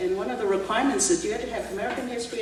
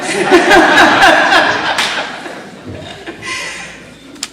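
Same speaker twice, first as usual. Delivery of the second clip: hearty laugh; 8 semitones higher, 12 dB louder than usual. Real, not acted. Laughs after joking about speaking Texan.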